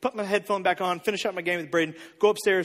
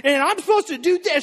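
put-on higher, louder incredulous child's questions; indignant daughter; 7 semitones higher, 4 dB louder than usual. Daughter challenging her dad over the verses, high and incredulous. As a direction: voice a kid who can't believe it.

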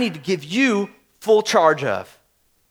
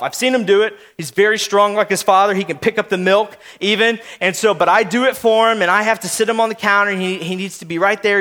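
ordinary delivery

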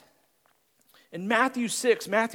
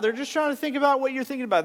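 normal talking voice

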